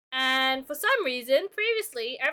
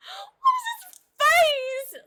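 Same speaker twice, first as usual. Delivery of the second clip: very high, slow cartoon mouse squeak; Mickey Mouse voice; 7 dB louder, much slower than usual. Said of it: "Mickey Mouse impression".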